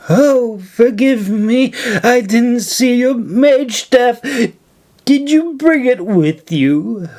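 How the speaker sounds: mock her with false politeness; mocking old woman. A narrator's reading.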